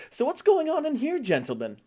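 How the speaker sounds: mock-polite threat with a knife at a throat; menacing question. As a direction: ask it like a casual threat. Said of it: "out loud be like".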